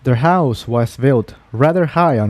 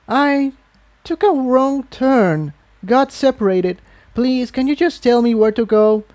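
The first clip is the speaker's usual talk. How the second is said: much higher, hesitant plea; pleading young mare; 11 semitones higher than usual. Acted. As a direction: beg for directions, a bit lost. Tiptoe pleads for directions.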